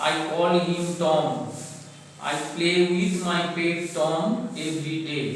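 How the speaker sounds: slow, phrase-by-phrase read-aloud; dictation-style reading. Shown as a demonstration. Teacher reads a pet paragraph to pupils, slow dictation style. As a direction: read out a simple paragraph with pauses after each phrase.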